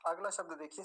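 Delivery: ordinary delivery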